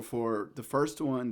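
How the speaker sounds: usual speaking voice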